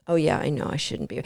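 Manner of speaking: low, soft, half-whispered, like the life has drained out; a dog going through the motions, barely voiced; lifeless dog voice. Trainer voicing a bored dog in a lifeless, soft whisper. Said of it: "with a lifeless expression".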